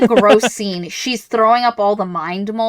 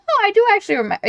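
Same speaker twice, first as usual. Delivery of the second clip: mock surprise; 12 semitones higher, much faster than usual. Imagined self rereading the book.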